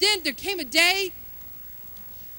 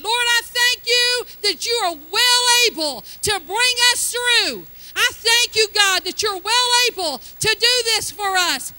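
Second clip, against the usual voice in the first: higher and louder, crying out thanks to God; loud thankful prayer; 5 semitones higher, 5 dB louder than usual. Retold or imitated. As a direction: shout your thanks to the Lord with full faith. Retold prayer over the IRS bill.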